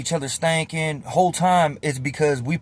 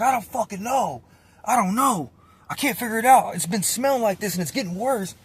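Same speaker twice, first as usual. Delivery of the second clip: higher-pitched exasperated retelling; 8 semitones higher than usual. Retold or imitated. Cellmate baffled by a worsening smell, exasperated. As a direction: imitate a cellmate at his wits' end.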